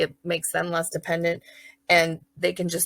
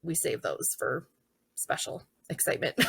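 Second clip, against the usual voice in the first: soft aside; 3 semitones lower, 9 dB quieter than usual. Shown as a demonstration. Teacher sets the rule.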